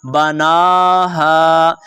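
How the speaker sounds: बनाहा with the ना stretched long; long NOON vowel. Shown as a demonstration. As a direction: hold the "naa" in बनाहा, slow recitation. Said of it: "आपने नून को लंबा करना है".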